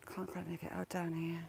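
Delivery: quiet whisper in church. Real, not acted. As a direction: whisper it so nobody hears. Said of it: "be prepared for me whispering".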